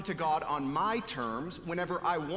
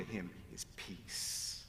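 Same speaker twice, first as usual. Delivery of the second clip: hushed; 10 semitones lower, 11 dB quieter, much slower than usual. Real, not acted. Soft close on peace.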